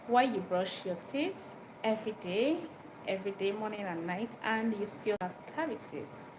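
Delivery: normal talking voice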